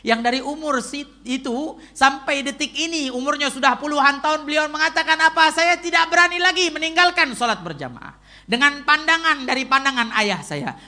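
high, loud sermon climax; full-force preaching. Acted. Preacher quotes an aged scholar's vow, proclaiming it loudly.

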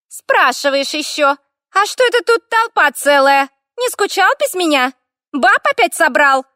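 high, loud, creaky jealous nagging; jealous fire elemental. A narrator's reading. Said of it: "В интонациях элементали явно угадывалась и ревность". (ревнивый скрипучий писк элементали)

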